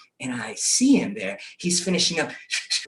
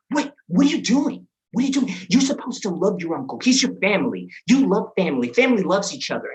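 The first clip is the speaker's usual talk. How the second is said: higher, louder mock telling-off; emphatic, raised-pitch parental lecture; scolding mother; 6 semitones higher, 4 dB louder than usual. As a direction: imitate a mom reprimanding her kid. Mom scolding him in a story.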